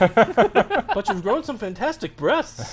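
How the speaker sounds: high, slow, hammed-up delivery; movie character impression. Finishing a comedy film quote for friends, hammed-up high voice.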